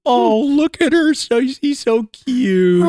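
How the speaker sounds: high, slow cooing over a puppy; cooing baby talk. Acted. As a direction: gush like a doting owner.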